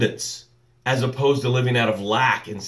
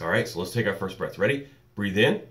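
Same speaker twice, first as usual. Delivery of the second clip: lower, soft breathing cues; guided breathing; 4 semitones lower, 14 dB quieter than usual. Acted. Host leads the morning audience in soft breathing. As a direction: guide them gently through each breath.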